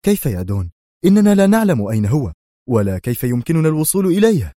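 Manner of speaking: baffled henchman; bewildered protest. Acted. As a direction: plead helplessly. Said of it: "يقول في حيرة".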